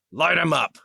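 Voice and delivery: battle cry. Acted. Sergeant ordering his wingmen to fire, loud battle cry. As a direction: bark the order to open fire.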